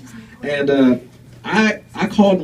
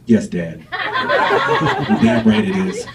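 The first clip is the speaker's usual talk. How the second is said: slow, deadpan, drawn-out affirmation; deadpan reply; 6 semitones lower, much slower than usual. Retold or imitated. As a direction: lie with a straight face, very slowly. Deadpan lie to father.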